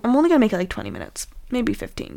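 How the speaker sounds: normal talking voice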